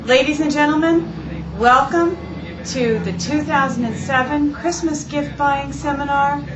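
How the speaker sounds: ordinary talk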